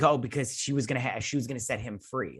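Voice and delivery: ordinary talk, nothing marked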